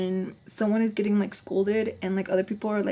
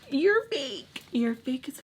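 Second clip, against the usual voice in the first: mock calling out; 7 semitones higher than usual. Inner reaction to friend's betrayal.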